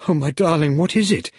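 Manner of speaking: high, distressed woman's voice; upset, high-pitched concern; on the verge of tears. A narrator's reading. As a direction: rise high with tender alarm. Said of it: "she said and started to cry".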